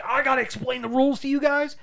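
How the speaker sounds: high put-on voice; imitated movie character. Movie fan pausing the film to lecture friends, high mock voice.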